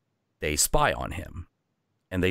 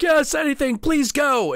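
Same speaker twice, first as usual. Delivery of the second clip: very high, squeaky begging; desperate surrender; 4 dB louder than usual. Acted. Innkeeper surrendering to the little Viking, high and frantic. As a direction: give in to anything, frantic.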